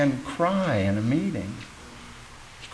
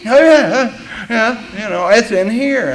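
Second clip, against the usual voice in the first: eager agreement; 7 semitones higher, 5 dB louder than usual. Retold or imitated. His eager reply retold.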